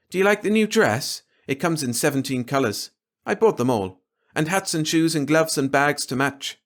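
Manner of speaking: high, lightly voiced young woman; showing off daughter. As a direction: preen and show off the dress. Glory showing off new dress.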